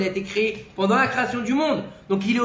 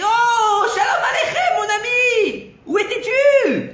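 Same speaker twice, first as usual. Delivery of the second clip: crié très aigu et très fort, étiré; il hèle son ami de loin, à pleine voix; cri de joie; 6 dB louder, slower than usual. Retold or imitated. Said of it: "Et là, il crie". (il crie de joie en retrouvant le vieil homme, très aigu et fort)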